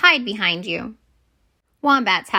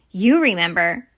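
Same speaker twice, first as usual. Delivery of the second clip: ordinary delivery, nothing marked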